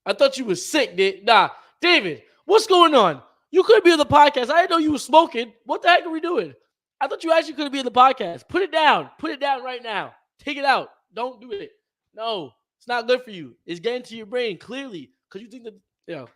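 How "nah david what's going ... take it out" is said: loud, high playful telling-off; mock scolding. Streamer jokingly scolds David for smoking, loud mock telling-off. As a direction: scold your buddy like you caught him red-handed, but it's a bit.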